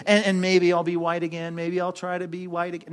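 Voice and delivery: monotone, hesitant muttering of "maybe"; half-hearted hedging, fading at the end; mock uncertainty. Shown as a demonstration. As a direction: waver as if doubting the promise. Mock doubtful misquote of David.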